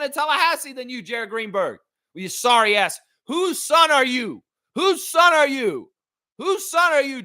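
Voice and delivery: outraged berating of the announcer; angry rant. Real, not acted. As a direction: tear into him. Ranting at Greenberg.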